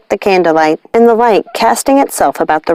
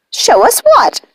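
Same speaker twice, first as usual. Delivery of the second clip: high, sharp witch voice; demanding witch; 14 semitones higher, slower than usual. A narrator's reading. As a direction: demand to know. Sisters demanding.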